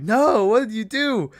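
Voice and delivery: high, shocked protest; mock horrified no; shocked cry. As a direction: cry out in disbelief at the shooting. Friend just shot Bill Murray.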